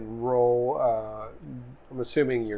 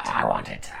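goblin voice. Acted. GM voicing a goblin.

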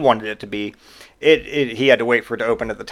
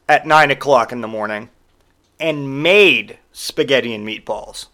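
normal talking voice

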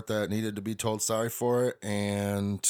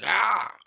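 pained yelp; 11 semitones higher, 7 dB louder than usual. Retold or imitated. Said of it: "it's like".

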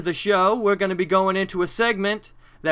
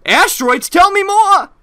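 high, loud, eager ad customer; chipper 1950s ad; 11 semitones higher, 7 dB louder than usual. Acted. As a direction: play the overeager customer in an old commercial.

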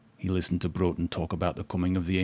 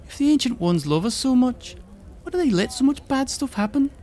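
put-on little girl voice; 3 dB louder than usual.

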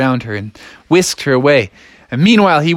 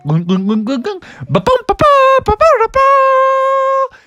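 rising gulps into high bouncy nonsense syllables; gulping noises, then bouncing. Acted. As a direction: gulp faster, then burst into high ba-ba sounds. Imitates gulping, then juice kicking in.

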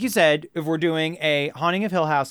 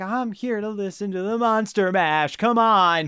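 mock partygoer voice; 5 semitones higher than usual. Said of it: "And it's like".

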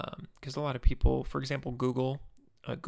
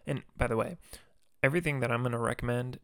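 ordinary delivery

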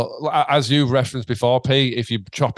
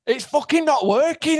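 re-enacted panic, pitched way up; panicked. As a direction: demand answers in a comic panic. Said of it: "it was a moment of panic".

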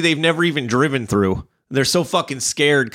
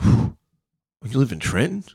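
mocking coworker sneer; 4 semitones higher, slower than usual. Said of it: "The guy goes".

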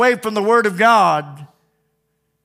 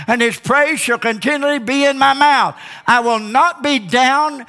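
rising, higher preacher's fervor; preaching; 7 semitones higher than usual. Acted. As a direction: lift it like a revival sermon.

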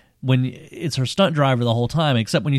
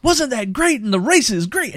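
excited announcer; 12 semitones higher than usual. Retold or imitated. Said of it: "who is".

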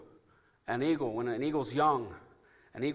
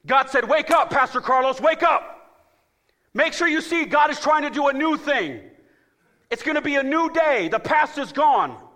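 high, loud, slowed preaching cadence; full-force preaching; 13 semitones higher, 13 dB louder than usual. Acted. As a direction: preach it out to the church.

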